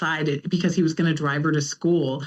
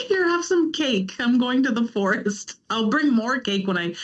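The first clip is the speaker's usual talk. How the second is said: breezy bribe; 6 semitones higher than usual. Retold or imitated. Bribing partner with cake.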